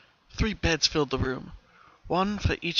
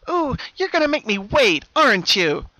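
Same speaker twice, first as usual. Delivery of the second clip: very high, loud, mock pouty whine; the double Pinkie pouting in the hallway; disappointed; 9 semitones higher, 11 dB louder than usual. A narrator's reading. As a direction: sulk playfully at being kept waiting. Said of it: "sounding disappointed".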